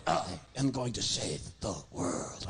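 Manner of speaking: low, slow, epic hero voice; heroic declaration. Acted. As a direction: announce it like a movie trailer. Puppet's grand heroic declaration.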